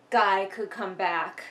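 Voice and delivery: ordinary talk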